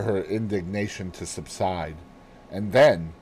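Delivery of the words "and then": ordinary delivery, nothing marked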